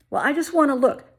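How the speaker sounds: high loud nosy stepmother voice; pushy stepmother. Retold or imitated. Imitating the stepmother reaching for her hair, high and loud. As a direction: mimic the tactless stepmother.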